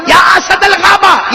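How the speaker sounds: high, loud and slow, summoning courage; a companion shouting bravely at a lion; bold call to a lion. Acted. Calling out boldly to the lion.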